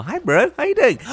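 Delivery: high cutesy voice for the horsey; cute greeting. Pretend. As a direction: coo it like talking to a foal.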